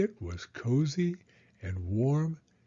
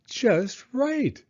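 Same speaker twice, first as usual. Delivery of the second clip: put-on bright high little-girl "Just right" said up high; delighted; 11 semitones higher than usual. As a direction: say "Just right" high and pleased, falling at the end. Aluki finds the perfect boots.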